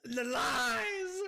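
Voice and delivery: high, slow, wailing protest; dramatic scream. Real, not acted. Streamer betrayed over a drink, dramatic scream. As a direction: wail at the betrayal. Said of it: "That scream just made Summer jump".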